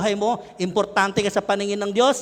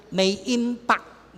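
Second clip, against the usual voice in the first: "impact" drawn out very slowly; slow, deliberate stress on "impact"; emphatic IMPACT; 3 semitones higher, much slower than usual. Shown as a demonstration. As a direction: stretch out "impact" with weight. Correcting mispronounced variants of impact.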